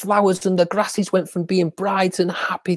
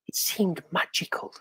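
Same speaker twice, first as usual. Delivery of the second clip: whispering; 8 dB quieter than usual. A narrator's reading. Magical moment.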